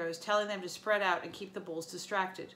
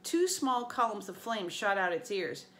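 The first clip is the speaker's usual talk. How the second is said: usual speaking voice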